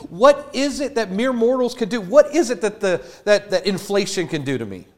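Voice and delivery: David scoffing at what mortals can do; David defiant. Retold or imitated. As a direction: challenge every fear, fired up. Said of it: "He's like".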